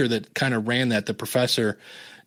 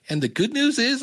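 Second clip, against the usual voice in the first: much higher, leading question; expectant prompting; 10 semitones higher than usual. Retold or imitated. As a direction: imitate a boss fishing for the rest. Boss prompts him for the news.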